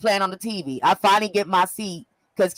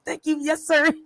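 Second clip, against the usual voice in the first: put-on sheepish little kid; 8 semitones higher than usual. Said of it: "I felt like a little kid".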